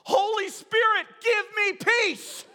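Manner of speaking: high, loud imitation of a child yelling; bold yell. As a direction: cry it out boldly, stretching the last words. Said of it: "stands up boldly and yells".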